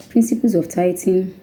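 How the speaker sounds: usual speaking voice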